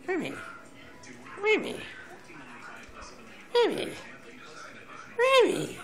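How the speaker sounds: high, sing-song calling of a name; calling the dog. Real, not acted.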